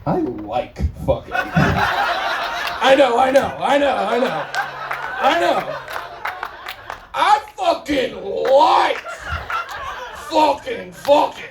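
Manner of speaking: hammed-up dopey guy, laughing; lightly voiced simpleton cracking up; slow giddy dim guy. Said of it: "my impression of a guy".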